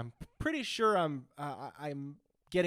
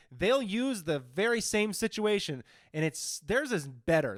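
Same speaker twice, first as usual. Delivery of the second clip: ordinary talk, nothing marked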